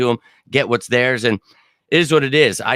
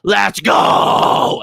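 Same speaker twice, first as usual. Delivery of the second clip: a sudden, higher, louder breathy yell of triumph; pumped-up victory cry; hyped shout; 8 semitones higher, 6 dB louder than usual. Retold or imitated. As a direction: shout it like you just finished your last exam. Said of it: "The first thing I thought".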